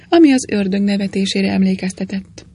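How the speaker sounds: plain reading voice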